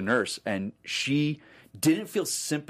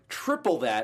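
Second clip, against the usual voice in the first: normal talking voice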